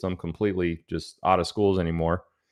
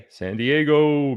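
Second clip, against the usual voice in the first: drawn-out shout-out; 7 semitones higher, 4 dB louder, much slower than usual. Acted.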